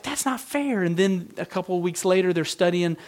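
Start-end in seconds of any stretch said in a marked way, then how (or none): 0.0-0.8 daughter's protest, voice pitched higher; high, indignant little girl's complaint; imitating young daughter. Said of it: "she's like".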